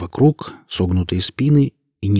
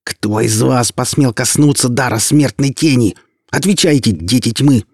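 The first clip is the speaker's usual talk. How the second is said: furious hiss; 5 semitones higher, 6 dB louder than usual. A narrator's reading. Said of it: "она яростно шипела".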